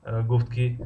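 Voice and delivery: ordinary talk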